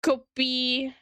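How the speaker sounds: kopi said with a light P, two even syllables; Korean word for coffee. Shown as a demonstration. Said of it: "coffee is".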